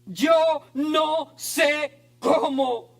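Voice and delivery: slow declaration. Shown as a demonstration. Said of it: "la declaración más espiritual".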